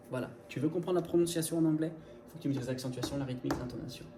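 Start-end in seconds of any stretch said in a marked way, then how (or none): none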